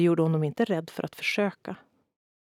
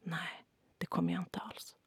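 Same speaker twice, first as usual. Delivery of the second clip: soft, much quieter whisper; 9 dB quieter than usual. A narrator's reading. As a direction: whisper it down at his shoes.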